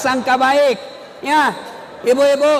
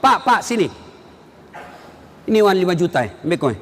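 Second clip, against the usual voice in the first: mock rude handout; 7 semitones lower, much faster than usual.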